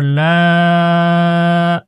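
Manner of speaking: লা held very long on a level note; three-alif madd. Shown as a demonstration. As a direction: sustain the aa of লা. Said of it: "তিন আলিফ টেনে পড়তে হবে". (লা তিন আলিফ টেনে পড়া)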